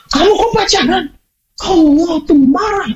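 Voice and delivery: high, shocked scolding parent; mock angry father. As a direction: play the judging father, shrill with disbelief. Father scolding daughter example. (ayah yang kaget dan memarahi, suara tinggi)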